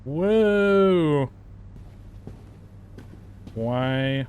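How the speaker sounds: ordinary talk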